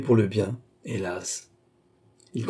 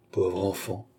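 ordinary delivery

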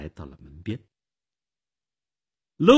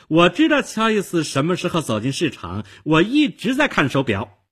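raised-pitch protest; defiant insistence; 8 semitones higher than usual. Acted. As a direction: insist on your story, chin up. Witness defiantly insists when challenged.

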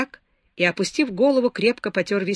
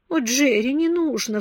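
mild sob, pitch higher; a touch of tearful pleading; sobbing plea; 6 semitones higher than usual. A narrator's reading. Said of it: "всхлипнула Беатрис". (со всхлипом, жалобно)

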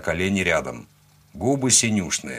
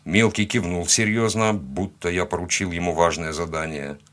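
ordinary delivery, nothing marked

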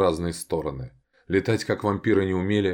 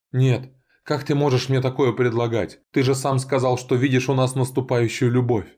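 higher, louder indignant protest; 6 semitones higher, 3 dB louder than usual. Acted. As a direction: push back, outraged and hurt. Indignant refusal of lewd offer.

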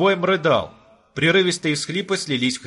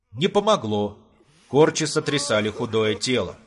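plain reading voice

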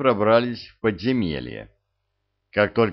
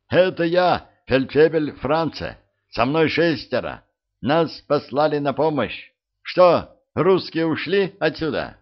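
calling out; 6 semitones higher than usual. A narrator's reading. Sergeant calls through the tunnel.

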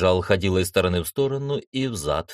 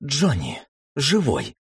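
mildly delighted, higher-pitched exclamation; overjoyed; 6 semitones higher, slower than usual. A narrator's reading. Little sister finds her brother alive, mildly overjoyed. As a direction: lift the pitch in glad relief. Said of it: "обрадовалась сестрёнка".

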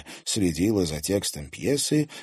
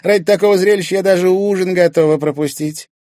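high, loud mocking voice; pitched-up theatrical scorn; sneering Irina; 12 semitones higher, 11 dB louder than usual. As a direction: scoff at the show with relish. Irina mocks the play.

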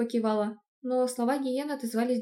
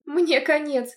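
plain reading voice

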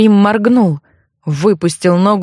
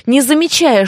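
usual speaking voice

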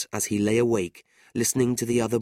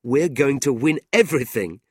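louder, higher jolly boast; cheerful; 6 semitones higher, 6 dB louder than usual. A narrator's reading. Nicholas celebrating the first game with Tilda, cheerful. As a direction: announce it in high spirits. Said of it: "Nicholas said cheerfully".

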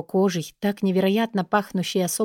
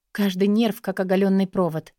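plain reading voice

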